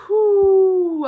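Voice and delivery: loud, high whew of relief; exhausted whew. Real, not acted. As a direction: blow it out like you're worn out. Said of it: "I'm exhausted".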